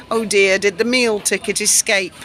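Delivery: ordinary talk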